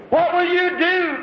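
high, slow pleading cry; a preacher voicing an angel's cry. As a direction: cry out the question as an anxious angel. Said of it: "cried the angel".

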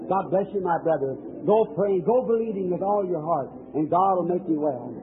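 ordinary talk, nothing marked

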